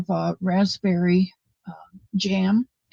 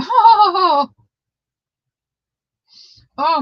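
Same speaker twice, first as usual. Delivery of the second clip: delighted surprise; 10 semitones higher, 6 dB louder than usual. Real, not acted. Delight at cute gift.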